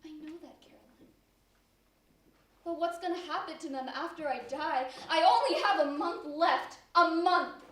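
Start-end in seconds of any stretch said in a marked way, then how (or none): 0.0-1.1 much softer, the name fading to a whisper; broken whisper. Acted. Elise to her best friend, barely holding back tears. 5.1-7.6 anguished outburst. Acted. Elise despairing outburst.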